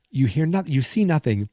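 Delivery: ordinary talk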